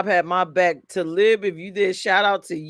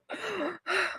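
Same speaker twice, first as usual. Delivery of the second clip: high, breathy shocked gasp done for effect; dramatic gasp; 13 semitones higher, 13 dB quieter than usual. Retold or imitated. Woman retelling her pipe story, staged dramatic gasp. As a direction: gasp like the news just hit. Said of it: "all I could do was go".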